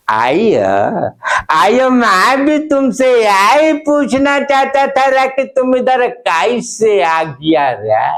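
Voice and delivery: lightly voiced South Indian Hindi; South Indian accent. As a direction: play a Madrasi friend, South Indian accent. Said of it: "दोनों का अंदाज आपके बीच पेश कर रहा हूं".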